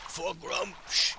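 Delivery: mock-heroic charge cry; orc battle cry. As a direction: call out the rallying cry.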